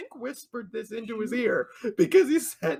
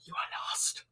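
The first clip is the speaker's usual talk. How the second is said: spooky whispered entrance-theme mimicry; hushed, ghostly mock whisper; eerie whisper; 6 dB louder, much slower than usual. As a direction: whisper it like a haunting intro.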